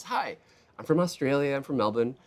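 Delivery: a light imitation of an Australian accent, pitched high; chirpy Australian greeting, lightly put on. Mai greeting the speaker in a coffee line, lightly Australian. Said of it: "that's a very cool accent".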